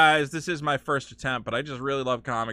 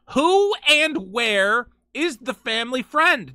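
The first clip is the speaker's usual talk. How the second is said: slow, higher and louder, WHO hit hard; dramatic slow reading; 8 semitones higher, 6 dB louder, slower than usual. Shown as a demonstration. Streamer reading the synopsis hook aloud, building drama. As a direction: read it like a movie trailer hook. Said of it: "Who is the family friend?".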